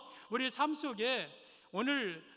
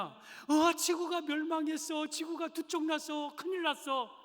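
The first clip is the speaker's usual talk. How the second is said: lightly raised, flustered little voice; rabbit; 6 semitones higher than usual. Acted. Rabbit telling the animals why it ran, flustered. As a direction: explain breathlessly, a bit rattled.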